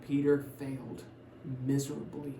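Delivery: ordinary talk, nothing marked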